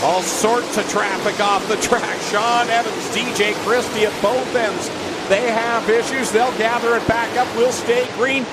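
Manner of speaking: lively live race play-by-play; motorsport commentator. Real, not acted. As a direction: call the lead battle with rising energy.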